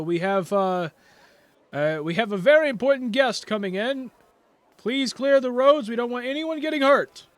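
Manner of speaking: high, loud guard announcing to the crowd; guard calling out. Acted. Guard ordering citizens off the road, loud and high. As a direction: call out to clear the street. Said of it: "it's like".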